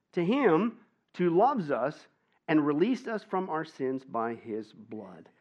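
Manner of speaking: slow solemn reading. Real, not acted. Reading scripture emphatically.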